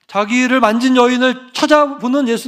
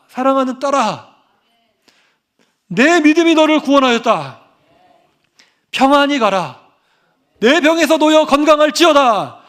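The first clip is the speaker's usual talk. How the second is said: higher and louder, declaring each phrase; 사랑하는 and 믿음이 and 놓여 hit high and loud; fervent preaching; 5 semitones higher, 5 dB louder than usual. Acted. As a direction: proclaim the verse with rising fervor over the congregation. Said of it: "이게 아니라".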